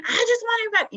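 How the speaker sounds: high, loud frustrated cry, cut off; mock scream. A mother showing how she'd scream at her kids. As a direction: squeal it out like a parent at the end of her rope. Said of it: "instead of me screaming".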